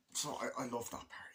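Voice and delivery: ordinary delivery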